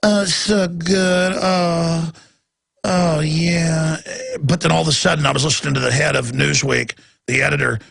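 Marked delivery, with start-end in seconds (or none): 0.0-4.0 slow, syrupy mock radio voice; lightly voiced soothing announcer; a hint of a sugary radio host. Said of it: "and it's all just".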